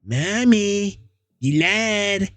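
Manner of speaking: put-on high, eerie childish voice, unsettlingly calm; a disturbed boy explaining himself sweetly to mom; high little-boy voice with creepy calm. [name]'s voice held.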